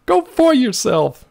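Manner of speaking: high, slow pun on "for yourself"; playful pun. Pretend. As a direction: stretch the pun on "for". Pun on foyer.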